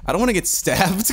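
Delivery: high, mock-anxious worry; retold stressing out. Storyteller replays fearing a stabbing, comically stressed. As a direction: replay nervous dread half laughing. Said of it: "I just started, like, stressing out".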